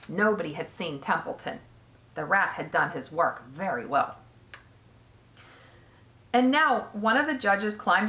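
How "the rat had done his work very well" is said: ordinary delivery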